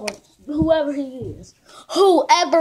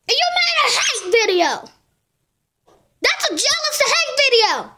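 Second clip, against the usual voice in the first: angry rant; 7 semitones higher than usual. Real, not acted. Ranting angrily at YouTuber.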